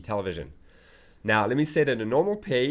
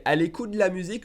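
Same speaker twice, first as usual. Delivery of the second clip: quick run-on French, higher, "de la" squeezed to "dla"; fast version; 7 semitones higher, much faster than usual. Shown as a demonstration. Teacher shows how it sounds in conversation, fast. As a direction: rattle it off at native speed. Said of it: "If I said it faster".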